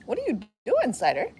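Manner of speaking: high playful question to a pet; dog-talk voice. Acted. Asking the dog what she's doing, high pet voice. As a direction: tease the dog sweetly.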